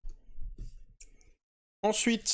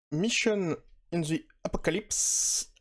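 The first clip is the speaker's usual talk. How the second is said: a slow, careful try at an English title with a French accent; drawing out Mission in the Apocalypse in hesitant English; mock English accent; 4 semitones higher than usual. Shown as a demonstration. Reading the English title to viewers, a slow mock English accent. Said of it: "mon superbe accent".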